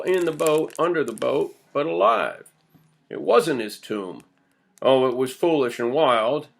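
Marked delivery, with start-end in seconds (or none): none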